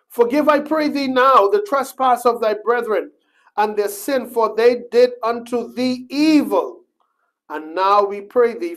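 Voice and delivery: high, loud, slowed proclaiming of the plea; loud scripture proclaiming. Acted. Preacher rereading the brothers' plea, loud and high. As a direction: proclaim it to the back row.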